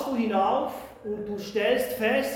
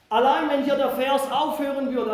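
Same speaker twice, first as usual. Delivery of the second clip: ordinary talk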